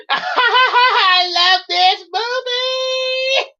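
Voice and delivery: high, slow words through laughter; laughing. Real, not acted. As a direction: gush while cracking up. Laughing at the video.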